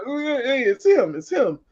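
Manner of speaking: high, eager fan greeting; excited greeting. Retold or imitated. Clubgoers spotting Henry, eager high greeting. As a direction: gush like a starstruck regular.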